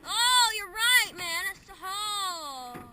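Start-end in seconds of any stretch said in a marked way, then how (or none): 0.1-1.4 loud, slow, drawn-out wow; excited exclamation. Real, not acted. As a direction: marvel at it loudly. Exclaiming about warm water.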